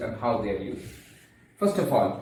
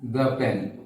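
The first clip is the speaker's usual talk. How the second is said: slow, higher, gentle asking; polite request; 3 semitones higher, slower than usual. Shown as a demonstration. Teacher showing a polite request to the class. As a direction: ask politely, not demanding. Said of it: "the manner in which I am speaking".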